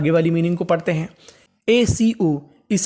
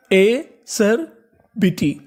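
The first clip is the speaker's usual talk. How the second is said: "एसरबिटी" very slow, in separate pieces; teacher breaking "एसरबिटी" into slow, spaced chunks; syllable by syllable; much slower than usual. Shown as a demonstration. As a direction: sound out "एसरबिटी" with long gaps between the parts. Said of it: "तो पूरी meaning क्या बनी हमारी".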